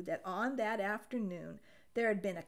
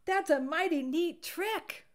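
higher and louder, admiring; impressed; 8 semitones higher, 7 dB louder than usual. A narrator's reading. Dog admiring Duck's trick, higher and louder. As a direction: sound really impressed. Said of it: "what he thought was".

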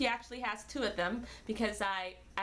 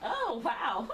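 ordinary talk

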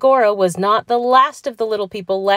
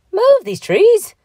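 high, loud, slow incredulous question; outraged cry; 7 dB louder, slower than usual. A narrator's reading. Patrick hears his trees will be moved, outraged cry. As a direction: explode in disbelief. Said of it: "in a purple rage".

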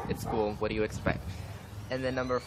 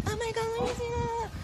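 much higher, breathy-soft gushing imitation; mock squeaky gushing girl; 5 dB quieter than usual. Mocking a hair-touching classmate, gushing, very high voice. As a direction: mimic someone cooing over your hair. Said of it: "They're like".

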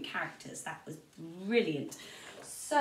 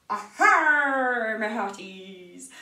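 loud, hammy pirate greeting; 9 dB louder, much slower than usual. Acted. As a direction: call out like a jolly pirate. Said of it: "be a pirate".

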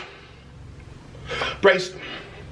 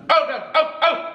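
ordinary talk, nothing marked

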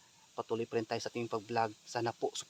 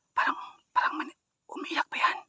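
tense breathy whisper; whispering while hiding; 7 dB louder than usual. Real, not acted. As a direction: whisper so no one hears. Whispering near the hut.

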